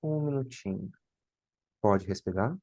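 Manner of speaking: soft, slow breathing coach; guided breathing. Acted. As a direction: count them through it gently. Guides a timed breath hold.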